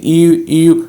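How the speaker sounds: ई high, thin and drawn out; thin long ü. Shown as a demonstration. Teacher drilling the ü vowel, thin and long. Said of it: "बारीक और लंबा करके पढ़ेंगे".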